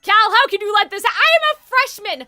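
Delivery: high, loud, frantic yelling; a cornered freshman near breaking point; panicked shout. Acted. Cal yells at the bickering wardens, high-pitched panicked shouting.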